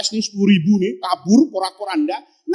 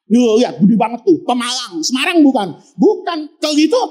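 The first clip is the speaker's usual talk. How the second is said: usual speaking voice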